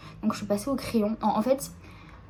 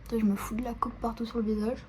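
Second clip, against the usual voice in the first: whispering; 4 dB quieter, faster than usual. Real, not acted. Mutters to herself, makeup spill.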